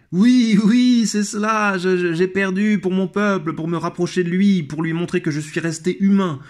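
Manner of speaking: Nero's eager reply. Retold or imitated. Nero answering the flattering poet, high, eager and self-pleased.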